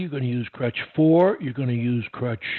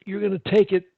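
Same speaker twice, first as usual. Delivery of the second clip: ordinary delivery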